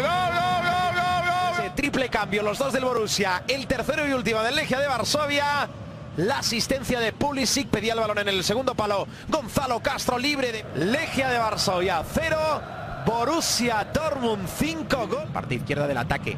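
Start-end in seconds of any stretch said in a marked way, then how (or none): 0.0-1.6 long high shouted gol call; goal call. Real, not acted. Commentator calls a goal. 1.6-15.3 breakneck goal commentary. Real, not acted. Post-goal commentary at full force.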